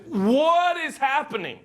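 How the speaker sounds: high, slow astonished question; bewildered. Retold or imitated. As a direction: ask as if the earth itself is shaken. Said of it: "the elements respond with".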